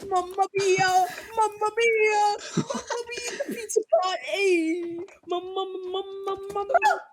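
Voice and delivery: high, laughing Italian chef voice; jolly italian pizza chef. Acted. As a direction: ham up a pizza chef's Mamma mia. Mamma Mia pizza bowl bit.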